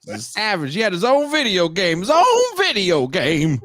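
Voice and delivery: put-on high-pitched, rambling old-timer; old barbershop guy. Old man telling young folks about Tyson's video game, hammed-up impression. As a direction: boast and lecture in a put-on old man's voice. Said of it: "Why do I sound like Bill Cosby?".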